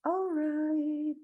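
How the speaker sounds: high, stretched-out alright; playful sing-song. Real, not acted. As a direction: draw it out on a held high note. Playful drawn-out repeat of alright.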